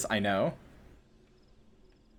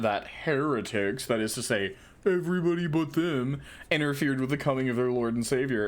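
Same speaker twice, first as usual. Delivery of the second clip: jock voice; 3 semitones lower than usual. Acted. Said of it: "a jock voice for all these bow guys".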